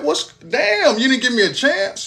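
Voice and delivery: high, aggrieved squeal at God; high disbelief. Retold or imitated. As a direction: whine up high like you got cheated. Complaining to God, shot.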